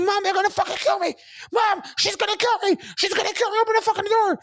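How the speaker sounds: high, loud panicked shriek; terrified scream. Retold or imitated. Terrified boy shrieking to his mom through the door. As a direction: scream for your life to your mom.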